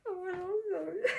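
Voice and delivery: high, laughing protest; laughing disbelief. Acted. Teasing the girls about their music, laughing. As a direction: squeal it through laughter.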